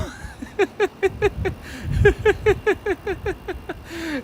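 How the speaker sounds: long high breathy laugh; real amused laugh, a high giggle. Real, not acted. A shop owner cracking up at his own kid impression, high laugh.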